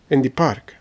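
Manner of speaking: usual speaking voice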